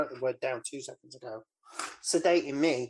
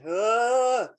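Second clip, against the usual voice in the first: mock scream; 9 semitones higher, 7 dB louder than usual. He's up there screaming.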